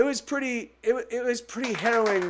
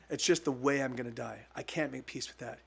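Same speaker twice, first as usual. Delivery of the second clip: choked up; 8 semitones lower, 7 dB quieter, faster than usual. Real, not acted. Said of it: "I'm choking up right now".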